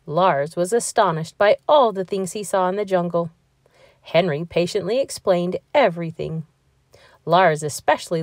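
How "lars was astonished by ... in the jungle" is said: ordinary delivery, nothing marked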